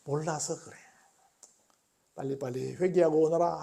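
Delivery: soft, patient plea; hushed, forgiving call to return; without raising the voice. Acted. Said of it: "목소리도 높이지 않아".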